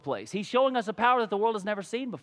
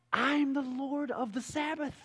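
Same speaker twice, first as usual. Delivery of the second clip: softer and slow, a calm final word; quiet, slow declaration; 3 semitones higher, 5 dB quieter than usual. Acted. Jesus quietly putting the leaders in their place.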